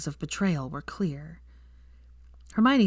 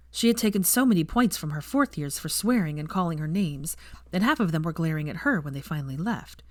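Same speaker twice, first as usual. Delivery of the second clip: ordinary delivery, nothing marked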